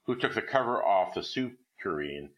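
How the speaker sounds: ordinary delivery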